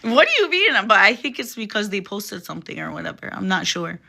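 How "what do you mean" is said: high, loud, excited self-quote. Retold or imitated. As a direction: reenact your own startled excitement. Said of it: "sometimes I get excited".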